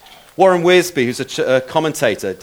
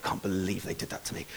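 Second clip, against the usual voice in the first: very low, very soft grumble; barely audible disgruntled muttering; muttering under breath; 9 semitones lower, 18 dB quieter, faster than usual. Shown as a demonstration. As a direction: mutter it under your breath, quick and aggrieved. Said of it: "muttering under your breath".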